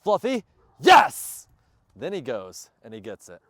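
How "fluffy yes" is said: high, loud, drawn-out praise to the dog; excited praise. Real, not acted. Trainer praising his dog, excited and loud. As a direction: cheer the dog on big and slow.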